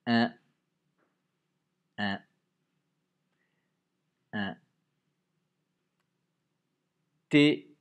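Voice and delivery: "téléphone" loud, each syllable detached; loud syllabified "téléphone"; dictation emphasis. Shown as a demonstration. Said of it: "Beaucoup de syllabes".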